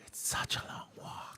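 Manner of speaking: whispered, weary aside. Real, not acted. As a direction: drop to a whisper. Long walk after hookup.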